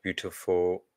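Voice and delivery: ordinary delivery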